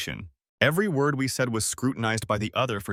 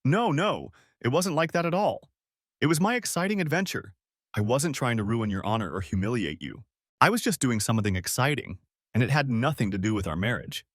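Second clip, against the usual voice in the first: ordinary delivery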